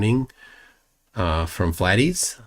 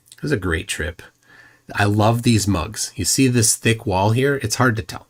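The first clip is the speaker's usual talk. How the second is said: ordinary delivery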